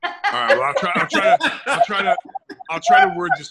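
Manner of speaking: loud, high-pitched laughing stammer. Real, not acted. Guest promising to word his story carefully, laughing. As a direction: laugh through repeated false starts. Amused at having to censor story.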